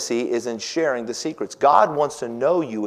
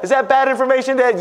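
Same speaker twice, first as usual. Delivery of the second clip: put-on much higher, louder little girl; high daughter voice; 12 semitones higher, 7 dB louder, faster than usual. Daughter asking her dad, voiced high. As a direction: pitch way up like a young girl.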